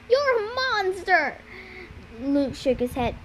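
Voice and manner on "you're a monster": high-pitched angry cry; yelled accusation. A narrator's reading. Annabeth denouncing Luke to his face, yelled accusation. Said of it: "she yelled".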